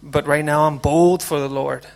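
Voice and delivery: ordinary talk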